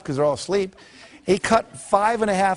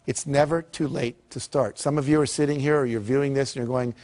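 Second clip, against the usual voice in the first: normal talking voice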